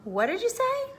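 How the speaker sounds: high, sudden astonished question; startled. A narrator's reading. The startled dodo asks the auk, high and amazed. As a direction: snap awake and ask. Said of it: "looked very startled and astonished".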